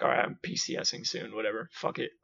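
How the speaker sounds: low, whispered shrug; resigned mutter. Retold or imitated. The speaker giving up before transferring out, low mutter. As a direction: let it all go under your breath.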